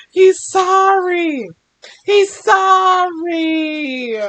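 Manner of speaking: very slow, drawn-out comforting. Real, not acted. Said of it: "Poor baby".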